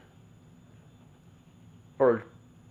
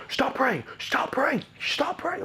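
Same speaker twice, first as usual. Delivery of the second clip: very high, strained mock yell; screaming dad; slower than usual. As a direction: shriek it like the raging father. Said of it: "he was getting crazy".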